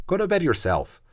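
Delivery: high, mocking jab back; a tired younger brother sniping at his sister; sulky retort. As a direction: throw it back at her. Edmund snaps back at Susan.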